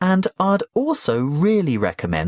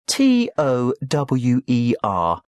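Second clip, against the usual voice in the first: slow spelling; 2 semitones lower, slower than usual. Acted. Spelling name for student.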